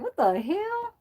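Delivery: high, squeaky disbelief; incredulous. Retold or imitated. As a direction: react in mock shock. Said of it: "I'm like".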